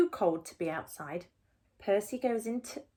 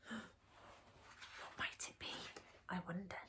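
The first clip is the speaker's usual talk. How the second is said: whisper; 3 semitones lower, 19 dB quieter than usual. A narrator's reading. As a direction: barely breathe the question. Continuing hushed suspense.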